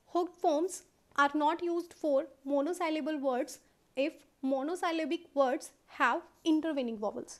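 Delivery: reading the rule out word by word, very slowly; slow, careful classroom reading of the rule. Shown as a demonstration. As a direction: dictate it slowly like a textbook rule. Reads rule from book slowly.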